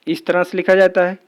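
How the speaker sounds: ordinary delivery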